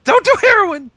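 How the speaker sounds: sudden high, loud warning; emphatic warning. Real, not acted. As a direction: shout the warning out. Urgent warning to cohost.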